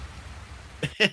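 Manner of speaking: airy laugh. Real, not acted. Laughing at the silent film.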